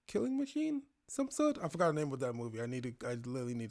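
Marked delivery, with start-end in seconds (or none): none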